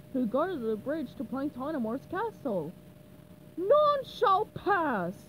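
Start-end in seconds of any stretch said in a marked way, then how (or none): 3.6-5.3 slow, loud booming challenge. A narrator's reading. Knight guarding the bridge to the castle, booming slowly. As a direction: boom it out slow and heavy. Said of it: "the Dark Knight boomed".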